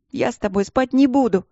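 high, tearful protest; indignant through tears. A narrator's reading. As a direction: object indignantly while close to crying. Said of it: "возмутилась Вики сквозь слёзы". (возмущённо, сквозь слёзы)

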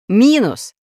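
sharp storybook yell; shouted call. A narrator's reading. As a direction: call out indignantly to the one who tricked you. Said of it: "крикнул он".

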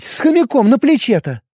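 high, insistent with hamster punched; emphatic. A narrator's reading. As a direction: stress хомяком, high and falling. Girl insists on hamster detail.

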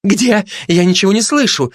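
high-pitched, flustered gossip; nervous high fluster; agitated. Acted. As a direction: get worked up, voice jumping high. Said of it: "заволновалась сплетница".

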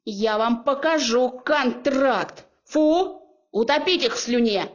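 медленно, грозно, «Фу!» выше и громче; натравить пса с угрозой; угрожающий приказ. Acted. Героиня натравливает пса на женихов. (героиня грозно командует псом против женихов)

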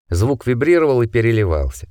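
ordinary delivery

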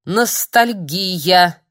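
«Ностальгия!» очень медленно и с весом; произнести «Ностальгия!» растянуто и громко, как неоспоримый довод; веско. Acted. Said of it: "веско повторил". (веско, медленно: «Ностальгия!»)

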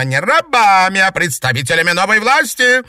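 very high, loud proclaiming; angry exalted. A narrator's reading. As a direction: rage about new power. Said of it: "разозлилась зеркальная ведьма".

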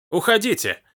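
sudden high, sharp "Уходите!"; sharp command. A narrator's reading. Old woman ordering the police out, sharp. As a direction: snap her away at once. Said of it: "резко сказала она".

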